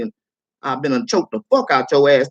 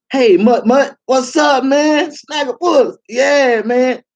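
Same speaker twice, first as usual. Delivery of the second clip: mock troll greeting; 9 semitones higher, 4 dB louder, much slower than usual.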